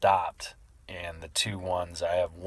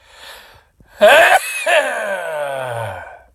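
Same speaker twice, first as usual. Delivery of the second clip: loud explosive sneeze; big real sneeze, full force; sneezing; 12 semitones higher, 16 dB louder than usual. Real, not acted. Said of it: "is try to sneeze".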